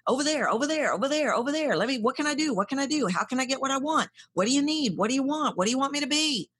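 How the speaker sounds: a lightly voiced needy octopus reaching everywhere; frantic grasping. Octopus tentacles grasping at everyone, lightly voiced pleading. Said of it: "your tentacles are just like".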